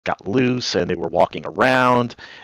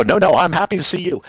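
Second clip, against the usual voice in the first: higher-pitched insistent denial; retold hasty reassurance; 8 semitones higher than usual. Man retelling his answer to his future wife, insistent.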